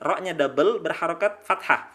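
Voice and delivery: ordinary talk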